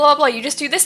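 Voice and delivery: normal talking voice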